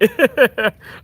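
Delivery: sudden high bright laugh; loud burst of laughter. Real, not acted. Laughs while reading chat.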